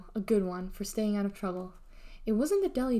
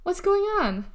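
high, loud holler; calling up; 10 semitones higher, 8 dB louder than usual. A narrator's reading. Mother questions the children upstairs, calling out. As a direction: shout the question to another floor.

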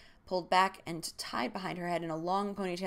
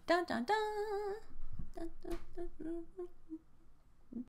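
high mock cliffhanger sting, fading away; dramatic "dun dun dun"; 11 semitones higher, 4 dB quieter, slower than usual. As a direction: sing out a suspense sting and let it die off. Dramatic sting fading after chapter.

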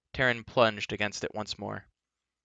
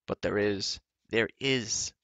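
eager cry; slower than usual. A narrator's reading. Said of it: "cried Glue".

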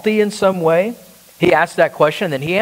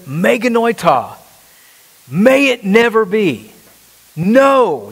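higher-pitched, forceful, drawn-out refusal; emphatic denial; 4 semitones higher, slower than usual. Acted. Preacher voicing Paul's answer to a question, emphatic denial. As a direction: deliver it as an absolute, emphatic no. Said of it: "He answers again in the emphatic".

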